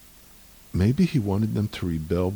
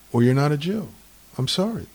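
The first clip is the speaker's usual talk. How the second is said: ordinary talk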